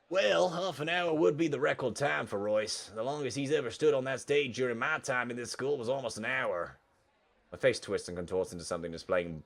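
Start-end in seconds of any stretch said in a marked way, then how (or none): 0.1-6.8 raised sing-song buddy voice; friend explaining. Acted. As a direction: answer knowingly, stretching out the bad news. Voices Leon answering the question.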